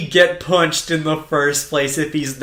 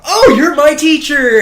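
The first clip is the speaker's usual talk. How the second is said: mock Shoichi; 11 semitones higher, 8 dB louder than usual. Shoichi voiced in retelling.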